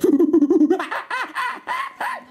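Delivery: high hoots breaking into monkey screeches; monkey chatter. Acted. Monkey asked to help the investigation, high chattering.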